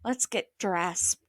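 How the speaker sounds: soft, slow, low near-whisper. A narrator's reading. Daughter to her sister, slipping away, whispering. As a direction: say it hushed and slow. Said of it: "Anne whispered".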